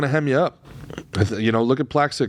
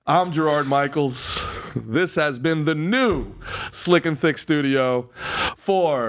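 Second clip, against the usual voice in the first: slow, higher announcer sign-off; show announcer; 4 semitones higher, much slower than usual. Acted. Host signs off from the new studio, slow announcer delivery. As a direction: draw out each phrase like an announcer.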